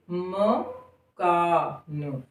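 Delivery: "मकान" drawn out very slowly and softly; stretched demo word. Shown as a demonstration. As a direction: stretch "मकान" with the mouth opening. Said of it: "हमारे होंठ खुले". ("मकान" को बहुत धीरे, होंठ खोलकर बोलना)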